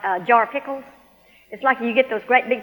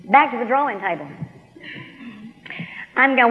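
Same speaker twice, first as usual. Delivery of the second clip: usual speaking voice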